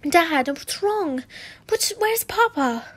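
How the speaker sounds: put-on high, slow worried girl's voice; anxious daughter. Lucy asking her dad where Papa is, anxious and high.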